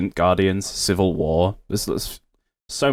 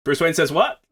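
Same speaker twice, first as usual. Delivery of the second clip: Gary Oldman; 6 semitones higher than usual. Acted. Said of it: "if Gary Oldman just went".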